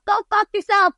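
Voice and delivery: high, loud shouted "Go fuck yourself"; yelled lyric. Retold or imitated. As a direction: belt the lyric out like a punk song. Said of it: "And the lyrics were like".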